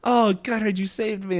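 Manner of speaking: put-on high, grateful old lady; Muriel's high voice. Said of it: "Muriel's just like".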